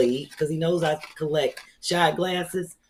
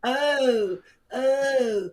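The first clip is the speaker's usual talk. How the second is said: crying; 7 semitones higher, slower than usual. Real, not acted. Overcome by gifts, crying.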